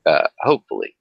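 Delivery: normal talking voice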